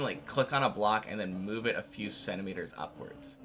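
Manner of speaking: ordinary talk, nothing marked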